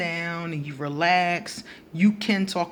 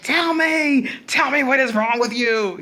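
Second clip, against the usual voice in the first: higher and louder, pleading in frustration; exasperated demand; 7 semitones higher, 5 dB louder than usual. Retold or imitated. To a shut-down Aquarius, exasperated demand. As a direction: push them to spill it, fed up.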